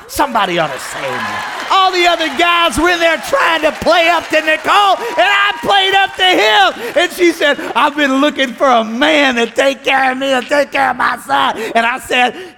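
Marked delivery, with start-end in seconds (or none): 1.7-12.5 loud, high preacher's shout; fired-up revival preaching; full-force preaching. Real, not acted. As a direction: testify at the top of your voice.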